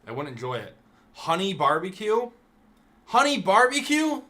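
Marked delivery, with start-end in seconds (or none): 1.1-2.3 "Honey barbecue?" with a high, louder rising question; incredulous question. Real, not acted. Streamer reaching the sauce he hates, in disbelief. 3.1-4.2 "Honey barbecue?" yelled much higher and louder; outraged shout. Real, not acted. Streamer repeating the hated sauce to chat, in a shrieking outrage.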